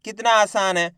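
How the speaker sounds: ordinary delivery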